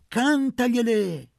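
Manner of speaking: high, very loud and slowed, the peak of the chant; women urge Luca on, loudest and slowest; building cheer. A narrator's reading. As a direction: hold it out as the climax.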